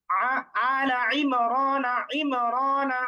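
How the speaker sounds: "আলা ইমরানা" recited very slowly, each sound drawn out in Quranic style; medium ain recitation. Shown as a demonstration. Teacher reciting slowly to show the ain. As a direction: chant "আলা ইমরানা" slowly, giving the ain a middle firmness. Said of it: "আমরা যদি আইনের উচ্চারণ করি".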